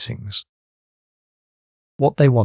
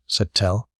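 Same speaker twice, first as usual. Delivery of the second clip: ordinary delivery, nothing marked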